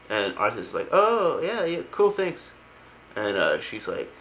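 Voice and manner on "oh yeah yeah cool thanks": high-pitched mock thanks; imitated offhand, high thank-you; casual acceptance. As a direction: accept lightly in a raised voice. Retold prince awkwardly accepting an offer.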